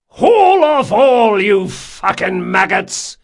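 slow, high, very loud command; cursing bellow. A narrator's reading. First mate abusing imaginary crew, bellowed. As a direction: drive the crew with a drawn-out roar.

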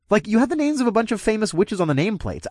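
high, excited disbelief; surprised. Acted. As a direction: voice jumps up in surprise. Startled realization about bodies.

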